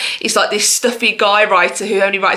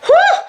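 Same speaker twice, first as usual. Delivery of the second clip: a loud, high, laughing whew of relief; a cheery high exhale; 5 dB louder than usual. Real, not acted. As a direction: blow out a big whew. Relief after a long list.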